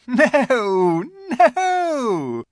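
high, loud, lilting laugh on the words; laughing refusal. A narrator's reading. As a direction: laugh off a boy's request, sing it high. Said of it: "said the Queen with a laugh".

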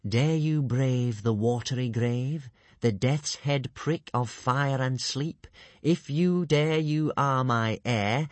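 slow, solemn recitation; recited riddle. Acted. Alvin reciting the riddle to the tribe, slow and solemn. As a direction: chant it like an old curse.

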